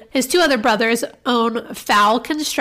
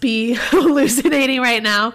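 laughing. Retold or imitated. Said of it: "She laughs hysterically".

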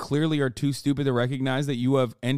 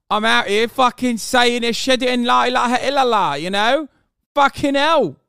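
mock London lad, loud and high; hammed-up British bloke, yelling; rough london slang; 5 semitones higher, 5 dB louder than usual. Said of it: "Typical fucking British idiot".

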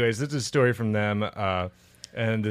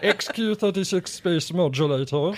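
nasal cartoon alien voice; clipped little cartoon alien voice; Marvin the Martian; 5 semitones higher than usual. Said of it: "like a Marvin the Martian".

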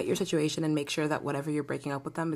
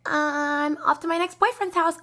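mocking girlfriend voice; 10 semitones higher than usual. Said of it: "tell him things like".

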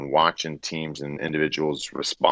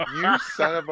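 high, laughing "you son of a"; cracking up at the jab; laughing mock outrage; 12 semitones higher, slower than usual. Real, not acted.

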